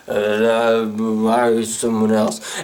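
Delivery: low, droning gibberish; unintelligible phone agent. Retold or imitated. Agent answers the order number, low droning gibberish. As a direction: mumble nonsense in a low monotone. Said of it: "And they're like".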